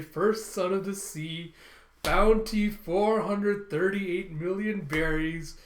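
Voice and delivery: dramatic drawn-out introduction; grand announcement. Retold or imitated. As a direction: proclaim the title and bounty, stretching the words. Announcing a character's title and bounty.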